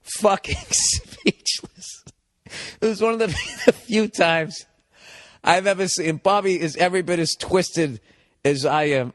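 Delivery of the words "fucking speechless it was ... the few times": laughing. Real, not acted. Laughing through the punchline.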